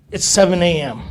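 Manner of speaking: usual speaking voice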